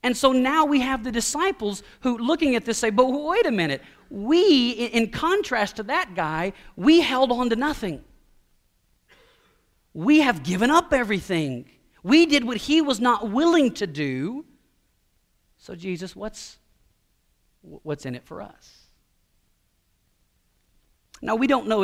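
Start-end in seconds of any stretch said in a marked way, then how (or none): 2.9-14.4 raised, insistent disciples' objection; emphatic protest. Acted. Disciples protest, contrasting themselves with ruler. 15.6-18.9 hushed, halting question after a protest; hesitant asking. Acted. Disciples timidly put question to Jesus.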